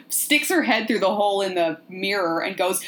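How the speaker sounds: normal talking voice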